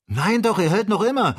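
high, loud, insistent contradiction; emphatic assurance. A narrator's reading. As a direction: call back insistently, pitched right up. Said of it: "versicherte Joe". (laut und hoch beteuernd)

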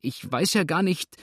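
high, helpless protest; frightened. A narrator's reading. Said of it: "rief Misses Bridleford erschreckt".

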